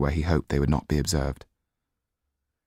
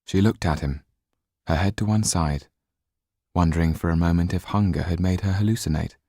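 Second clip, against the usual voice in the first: ordinary delivery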